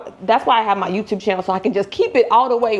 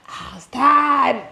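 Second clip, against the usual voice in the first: drawn-out, high, whiny tired; hammed-up weariness; mock tired whine; 6 semitones higher, much slower than usual. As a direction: stretch out the tired like a sigh. Mock weary correction.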